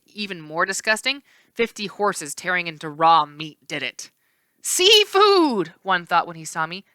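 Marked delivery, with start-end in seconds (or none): 4.6-5.7 high, loud monstrous horse; greedy beast calling; ravenous horse; hungry horse shout. A narrator's reading.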